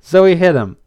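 ordinary delivery, nothing marked

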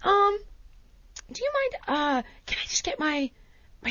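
very soft, halting, meek question; timid request. Retold or imitated. Customer asking another bakery worker for food, timid.

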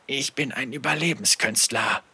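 soft growl with no force behind it; weak growl. Acted. Captain justifying himself after the killing, weak growl. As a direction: growl it quietly, without conviction. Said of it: "knurrte Tudre, aber es lag keine Kraft".

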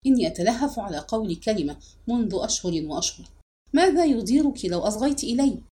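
ordinary delivery

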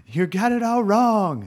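high, drawn-out mock scream; screaming at the phone as an exasperated listener; stretched, high-pitched mock outcry. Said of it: "Everyone in my generation's screaming".

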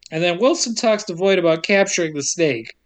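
normal talking voice

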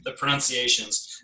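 ordinary talk, nothing marked

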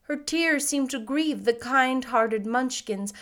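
ordinary delivery

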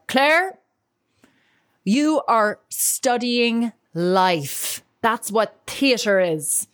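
imitating her dad's Irish accent, slow and tender; slow, loving Irish brogue. Irish father encouraging discouraged daughter, slow Irish accent. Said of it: "my Irish accent isn't as good".

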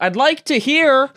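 slow, high drawn-out holler; long call to people outside; yelling. Real, not acted. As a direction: yell it, stretching each word. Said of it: "When you yelled".

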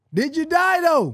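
Did you die though, pushed harder; emphatic repeat. Real, not acted. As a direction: lean in and punch die. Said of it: "You did, did you die though?".

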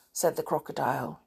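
usual speaking voice

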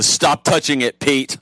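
teasing reprimand to Pete; mock scolding. Real, not acted. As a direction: scold a friend in jest. Teasing host for touching doors.

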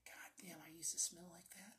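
hushed muttered aside; whispered aside. Retold or imitated. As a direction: say it under your breath. Muttering to himself about smokers.

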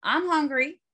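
usual speaking voice

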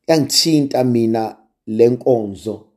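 ordinary talk, nothing marked

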